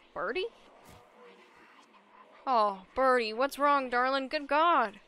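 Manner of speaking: usual speaking voice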